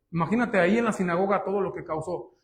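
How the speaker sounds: ordinary delivery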